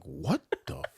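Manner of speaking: very low, hushed mock shock; stunned disbelief. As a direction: drop right down, as if dumbstruck. Retelling his stunned reaction to her.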